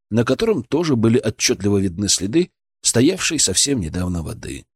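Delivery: usual speaking voice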